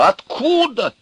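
very high-pitched demand; exasperated appeal. A narrator's reading. Sage questioning someone unseen, exasperated. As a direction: cry out the question to the heavens. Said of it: "вопрошал он кого-то".

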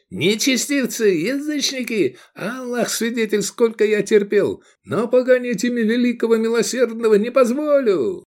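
high, ranting denunciation; fanatical outrage. A narrator's reading. Zealot denounces the pagans, sword drawn, in high fury. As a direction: denounce them in a sustained high cry. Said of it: "окрысился Тураб".

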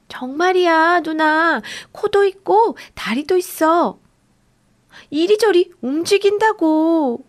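high, insistent shout; shouting again. Acted. Boy insisting to his doubting sister, high insistent shout. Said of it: "빌리가 다시 외쳤어요".

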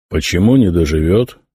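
ordinary talk

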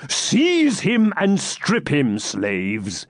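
slow, cold commanding knight; a cruel baron ordering his slaves; stern knight. Acted. Baron to his slaves, cold command.

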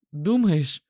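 hopeful. Acted. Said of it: "спросил он с надеждой".

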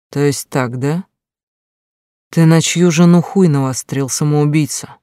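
cold and sober. Acted. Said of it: "до мурашек холодно и абсолютно трезво".

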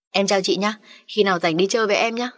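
ordinary delivery, nothing marked

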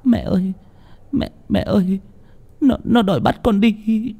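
very high, tearful whimper; acted sobbing. A narrator's reading. Revived girl tells her mother it came for her, sobbing. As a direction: stammer it out in a high crying voice. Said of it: "Sau đó khóc nức nở".

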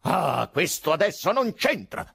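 high, forceful outburst; loud indignant interruption; Ababkov, thundering. A narrator's reading. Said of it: "tonò Ababkov".

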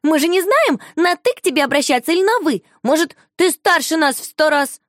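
put-on high, loud, rushed child voice; pushy little girl. A narrator's reading. Shusha keeps pressing the lady, high and rushed. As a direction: pile the questions on fast.

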